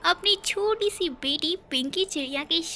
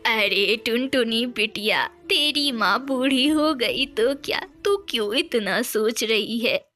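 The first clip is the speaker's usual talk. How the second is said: lower, firm granny voice; old grandmother; 4 semitones lower, 3 dB louder than usual. Acted. Grandmother urging her daughter to come home, low and firm. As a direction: insist like a loving old grandmother.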